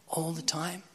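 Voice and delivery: hushed, questioning whisper; whispered thought. Retold or imitated. Preacher thinks over Fred's answer, whispered in wonder. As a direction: echo it under the breath as a private question.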